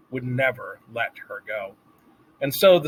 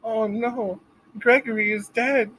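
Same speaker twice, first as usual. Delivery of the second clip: small mouse voice; 10 semitones higher than usual. A narrator's reading.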